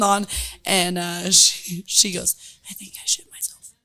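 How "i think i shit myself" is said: hushed, breathy confession; whisper. Retold or imitated. Drunk woman whispering to the bartender. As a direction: mutter it under the breath, embarrassed.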